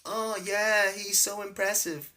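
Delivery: high-pitched mock-American gushing; American accent. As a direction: put on a high American accent, as if swooning over him. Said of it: "always do an American accent".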